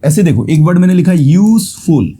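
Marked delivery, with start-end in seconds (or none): 1.1-2.1 "यूजफुल" as two beats, यूज-फुल; split into syllables. Shown as a demonstration. Teacher counting syllables for students, "यूजफुल" split as यूज-फुल. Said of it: "दो syllable है".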